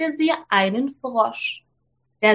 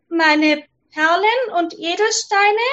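higher princess voice rising eagerly; 6 semitones higher than usual. Acted.

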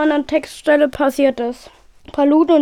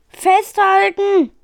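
high, drawn-out warning call; urgent shout; 6 semitones higher, 3 dB louder, much slower than usual. A narrator's reading. Pilot warns crew to hold on, urgent shout. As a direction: stretch it out as a slow, high shout.

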